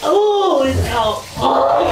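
high, sharp yelp at the cold water. Real, not acted. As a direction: cry out as the cold water hits.

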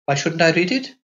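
lightly voiced girl asking quickly; quick girl's question. A narrator's reading. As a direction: she wants the paper now. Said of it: "she asked quickly".

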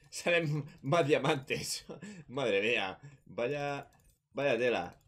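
delighted laugh while talking. Real, not acted. Finds more diamonds, laughing.

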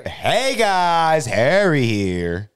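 loud, high vlogger greeting; excited internet voice. Acted. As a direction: start high and hyped, stretch the ending. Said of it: "your excited, you're on the internet voice".

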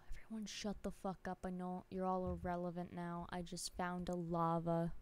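hushed and quick about a find; hushed excitement. Real, not acted. Streamer hushing chat over a discovery, softly. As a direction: hush everyone, quickly and softly.

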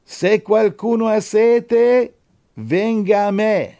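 slow, high, loud scriptural cry; ringing, drawn-out invitation from the pulpit; proclaimed call. Acted. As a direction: call out to the crowd, drawing out each word. Call of Jesus, exclaimed from scripture.

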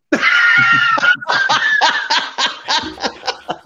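real high shouted laugh; loud burst of laughter. Real, not acted. Laughing out loud at the five-followers joke, loud shrieking laugh. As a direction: let out a big shrieking laugh.

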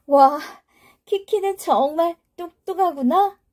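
high, wide-eyed wonder; amazed child. Acted. Child delighted.

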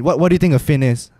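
usual speaking voice

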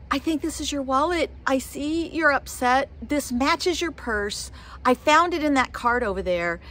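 lightly put-on high angelic voice; little angel voice. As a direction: imitate a little angel getting someone's attention. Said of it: "this little angel voice".

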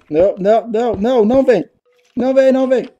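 frantic rising pleas, high and loud; panicked high cries. Real, not acted. Gamer cornered by a mob, frightened high cries. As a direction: beg the monster to stay away, panicking. Mob closing in during game.

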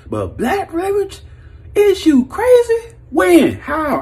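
high-pitched stunned disbelief; incredulous. Real, not acted. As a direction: ask it like you can't believe your ears. Incredulous continuation.

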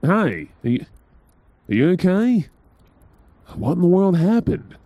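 rising, alarmed concern; worried. Acted. Hearing partner sick behind the locked door, worried and alarmed. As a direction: call through the door, suddenly scared for them.

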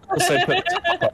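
bubbling over with excitement; excited high voice. Real, not acted. Excited plan to hide car.

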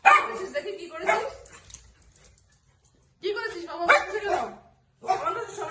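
high sing-song coaxing to a pet; pet talk. Real, not acted. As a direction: coax the pet gently, voice lifted. Coaxing the pet about mischief.

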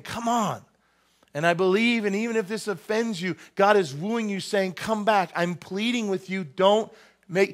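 high, earnest plea as God; pleading God voice. Acted. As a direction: beg them to come back.

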